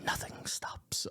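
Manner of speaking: slow, hushed delivery; soft, drawn-out whisper; whispered film quote. Acted. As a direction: whisper it slowly, with menace. Quoting the warden's line.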